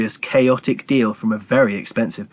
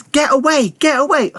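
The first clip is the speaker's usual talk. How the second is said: sudden high shout, much higher; burst out; 4 dB louder than usual. Acted. Cat Morris yelling at rats, fake tic shout. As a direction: blurt it out like an uncontrollable tic.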